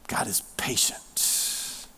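slow, hushed whisper. Real, not acted. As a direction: whisper it slowly and gently. Hushed sermon answer.

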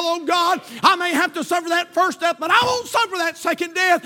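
loud, high altar call shout; preaching at full force. Acted. Preacher urging the lost to the altar, loud. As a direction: drive it home to the altar.

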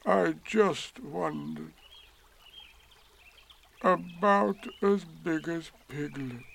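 slow, wistful musing to oneself; sad. A narrator's reading. Eeyore talking to himself about his balloon, sad. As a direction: draw the words out sorrowfully. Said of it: "he said to himself sadly".

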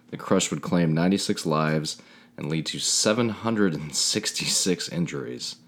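measured documentary narration; narrator voice. A narrator's reading. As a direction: read it like a solemn news intro. Reads the disaster intro.

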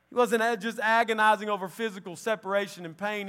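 fiery preaching. Acted. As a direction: preach it out with full force. Preaching at full force.

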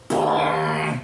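loud, low drawn-out boom; booming sound effect. Pretend. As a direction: boom it out like a light switching on. Game master imitates moonbeam switching on.

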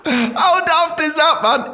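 high voice through laughter; laughing. Real, not acted. Comic tickled by a daft muscle name, laughing high. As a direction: squeeze the words out while cracking up.

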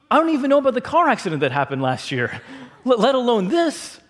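high, comic bewilderment; hammed-up flustered disbelief; incredulous protest. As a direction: protest that you are completely out of the loop.

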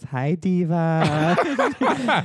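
imitating coworker's Armenian accent, slow and laughing; slow, amused Armenian greeting; a light Armenian accent, drawn out. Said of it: "She has this thick Armenian accent".

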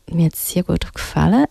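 ordinary talk

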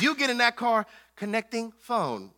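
ordinary delivery

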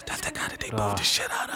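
soft whisper behind his back; whispered gossip. Retold or imitated. Students gossiping about the booed comic, whispered. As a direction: whisper it to a friend as he walks by.

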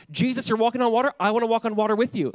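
bursting to join in; eager. Acted. Eager Peter voiced.

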